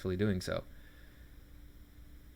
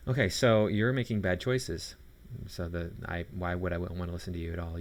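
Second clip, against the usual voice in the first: normal talking voice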